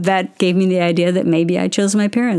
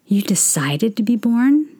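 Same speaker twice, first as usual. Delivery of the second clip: amazed half-whispered question; stunned whisper; 4 semitones higher than usual. Retold or imitated. Mother asking her toddler, amazed whisper. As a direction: breathe it out in disbelief.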